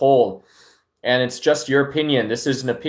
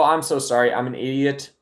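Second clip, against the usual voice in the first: ordinary talk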